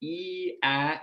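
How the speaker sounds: "ee" then "ah", held vowels; drawn-out sample vowels, jaw dropping; vowel demo. Shown as a demonstration. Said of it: "go ahead and try it".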